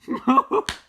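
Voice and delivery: high, loud cracking-up laugh; real burst of laughter. Real, not acted. Cracks up at cows ending.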